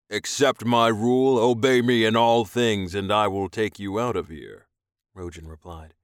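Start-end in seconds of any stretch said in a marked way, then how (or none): 0.1-4.7 loud, firm orders; commanding alien master, stern. A narrator's reading. Alien buyer setting terms for captive, stern command. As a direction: lay down the rules loudly.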